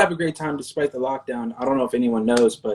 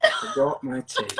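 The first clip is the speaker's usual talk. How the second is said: high-pitched put-on British voice; hammed-up British dismay, pitched high; mock British accent; 11 semitones higher than usual. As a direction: squeak it in a playful British accent. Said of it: "in your British accent".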